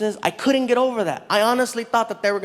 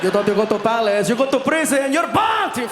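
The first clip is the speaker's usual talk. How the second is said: mock Middle Eastern host; 3 semitones higher than usual. Middle Eastern prankster springing the Punk'd trick, rising accented shout. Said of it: "some messed up episode of Middle Eastern Punk'd".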